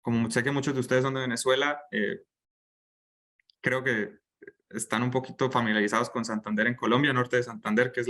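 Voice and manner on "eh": usual speaking voice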